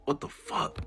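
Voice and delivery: stunned whisper; a hushed, frozen "what the fuck"; whispered disbelief. Retold or imitated. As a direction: breathe it out, too shocked for voice. Stunned under everyone's stare.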